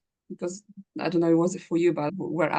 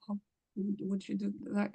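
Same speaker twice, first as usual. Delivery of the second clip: quiet rehearsing; 3 semitones higher, 10 dB quieter than usual. Retold or imitated. Rehearsing a request in head.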